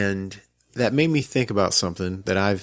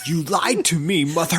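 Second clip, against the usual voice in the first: high, mock-dramatic child voice; mock accusation; 7 semitones higher than usual. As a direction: ham up a betrayed daughter. Husband voicing daughter's accusation.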